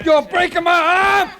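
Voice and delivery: sudden pained shout, pitch jumping up; pained yell. Acted. Bully yelping as Kendall twists his arm, pained shout.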